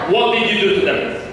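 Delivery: ordinary talk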